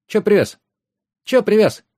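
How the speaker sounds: put-on high, insistent little boy's voice; ringing boy's shout. A narrator's reading. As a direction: pester in a high child's yell. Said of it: "звонко выкрикнул мальчонка лет семи-восьми". (звонкий мальчишеский выкрик)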